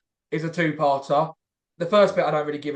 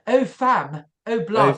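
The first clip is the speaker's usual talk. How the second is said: put-on higher, posh-boy street slang; mock middle-class gangster; 5 semitones higher, 4 dB louder, slower than usual. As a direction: ham up a wannabe gangster. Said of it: "the middle class gangsters".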